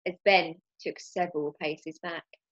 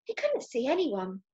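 usual speaking voice

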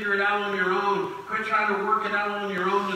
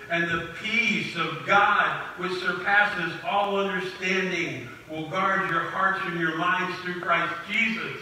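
slow scripture reading. Acted. Slowly reading Philippians aloud.

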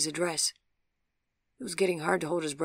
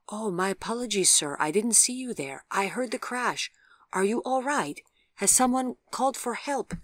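higher, lilting voice for an inquiring man; apologetic, then anxiously checking on a stranger; concerned passer-by; 6 semitones higher, 5 dB louder than usual. A narrator's reading. Man who heard the crash asks after an injured stranger, concerned and higher.

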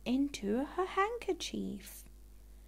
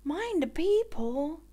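calling out; 6 semitones higher, 6 dB louder than usual. Acted. Warning call across the beach.

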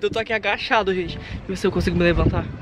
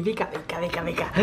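low, hushed coaxing call to the dog; soft coaxing; 5 semitones lower, 10 dB quieter than usual. Real, not acted. Owner coaxing the dog indoors, hushed and low. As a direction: coax the dog in softly under the breath.

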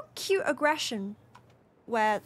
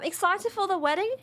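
ordinary delivery

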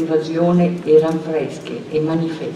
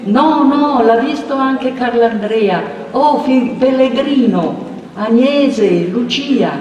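high, loud calling; 6 semitones higher, 3 dB louder, slower than usual. A narrator's reading. Villagers keep calling for Agnese and Lucia, loud. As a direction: call the names again.

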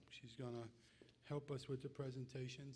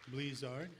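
calling out names. Real, not acted. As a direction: announce each name to the hall.

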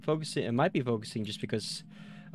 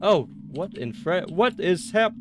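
alarmed; 5 semitones higher, 6 dB louder than usual. Real, not acted. Startled by sudden chaos in game.

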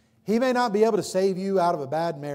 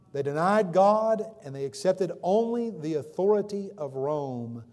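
ordinary talk, nothing marked

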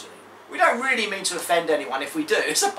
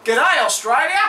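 put-on Australian accent; 7 semitones higher, 6 dB louder than usual. Greeting Australian viewers in their idiom.

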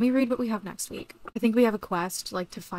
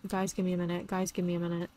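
usual speaking voice

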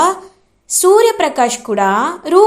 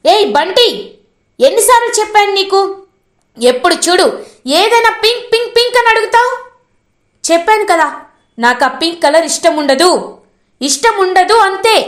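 put-on higher, fast scolding yell at the boy; father scolding his son over the pink lunch box, angry shout; 7 semitones higher than usual. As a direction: snap at the child, voice climbing high. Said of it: "గట్టిగా అరిచేస్తాడు".

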